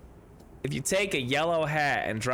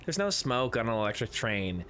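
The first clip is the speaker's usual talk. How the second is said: ordinary talk, nothing marked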